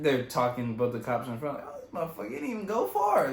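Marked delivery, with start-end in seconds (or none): 1.7-3.3 a hint of a high mocking cop; joking cop. Cops in front joke about the arrest, light mocking voice. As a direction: lightly voice the cops cracking jokes. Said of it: "They're like".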